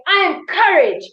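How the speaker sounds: punchy motivational affirmations; power statement. Shown as a demonstration. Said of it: "you're gonna say".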